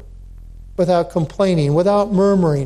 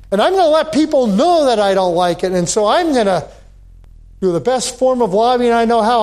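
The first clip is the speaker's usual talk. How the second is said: hammed-up grumbling in a raised pitch; mock complaining; 4 semitones higher than usual. Believer telling God he dislikes how things are run, mock complaining. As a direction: gripe at God like a discontented believer.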